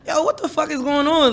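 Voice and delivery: high, startled "what is going on"; shocked outburst. Retold or imitated. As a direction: blurt it out in disbelief. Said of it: "I'm like".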